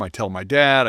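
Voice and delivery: ordinary talk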